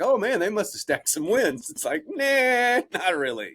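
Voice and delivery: high mocking fan voice, laughing at the end. Jokes about Jets quarterbacks, high mock reply. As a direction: tease the naive fan's hope. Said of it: "you'd be like".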